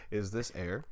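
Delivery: dry sarcastic question; deadpan mocking ask. Shown as a demonstration. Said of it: "I'm sarcastic, so I'm gonna do it sarcastically".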